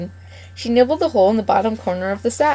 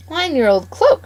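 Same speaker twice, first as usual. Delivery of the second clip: higher, louder indignant shout; 7 semitones higher, 4 dB louder than usual. Acted. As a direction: throw the words back at her. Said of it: "shouted Mister McGregor".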